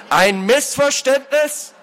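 high, slow incredulous outburst; like a whipcrack. A narrator's reading. Lord cutting off the culprit's excuse, outraged. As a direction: throw it back in outrage. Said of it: "erschallte wie ein Peitschenknall".

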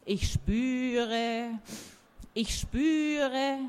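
slow, rising mystic murmur; mystic tree voice. Acted. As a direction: sense something like a seer. Oak sensing, in a story.

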